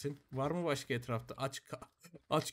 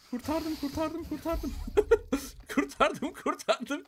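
high, excited cheering with a laugh; elated; 12 semitones higher, 5 dB louder, slower than usual. Real, not acted. Player celebrates saving his animals, elated. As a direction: squeal it in relieved triumph.

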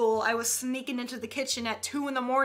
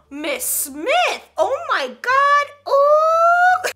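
high-pitched scandalized schoolkid; gasp, then build to a loud, high ooh; horrified fourth graders; 12 semitones higher, 7 dB louder, much slower than usual. Acted. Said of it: "They're just horrified".

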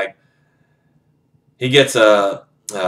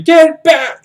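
high, loud scream of warning; 7 dB louder than usual. Retold or imitated. Felix screaming at his best friend to get back, high and loud. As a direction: scream it at your friend to save him. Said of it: "he starts screaming".

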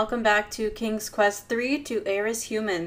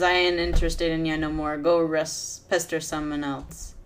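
usual speaking voice

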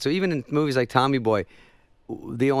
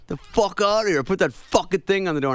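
irritated, raised groggy shout; grumpy mock yell; 10 semitones higher than usual. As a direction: bark it at the door half-asleep. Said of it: "And he goes".

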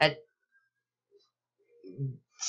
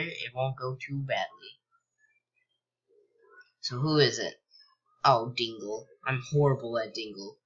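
usual speaking voice

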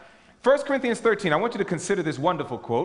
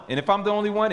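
usual speaking voice

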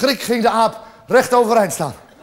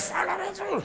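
tiny, very soft high voice; hushed high insult; 9 semitones higher, 14 dB quieter than usual. Acted. Neighbour to the woman opening the door, soft high voice. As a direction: squeak the insult out very softly.

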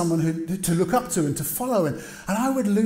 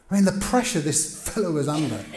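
ordinary talk, nothing marked

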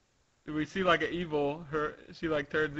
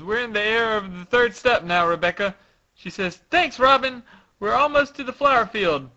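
higher, louder acted dialogue; projected character voices; 7 semitones higher, 8 dB louder, faster than usual. Robin and Rebecca talking in mid-air, projected acting. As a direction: call it out in character. Reading manga dialogue aloud.

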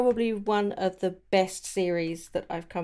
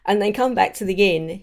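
ordinary talk, nothing marked

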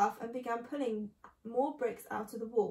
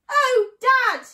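put-on high, very loud young fox's cry; thrilled child's shout; excited cry; 13 semitones higher, 15 dB louder than usual. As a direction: cry out to Dad in delight. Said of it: "he cried out".

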